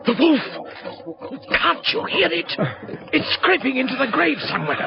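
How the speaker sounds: terrified. Acted. Friend in the graveyard hearing the wolf, terrified. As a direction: cry out in terror, then listen.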